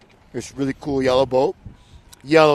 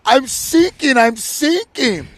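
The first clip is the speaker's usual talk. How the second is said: much higher, louder mock panic, slowing down; hammed-up cry for help; panicked sinking man; 12 semitones higher, 6 dB louder, much slower than usual. Sailor on sinking boat calling for help, hammed-up panic. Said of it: "the guy in the boat goes".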